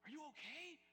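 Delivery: high, worried call from a friend running up; worried buddy. Retold or imitated.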